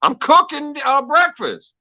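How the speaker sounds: put-on high innocent kid voice; a boy answering his mom, innocent; imitating himself as a kid. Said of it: "And I'm like".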